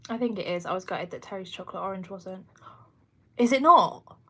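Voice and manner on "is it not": a loud, high gasp of disbelief; shocked, louder and higher; surprised. Real, not acted. Hearing her favourite chocolate isn't gluten-free, shocked.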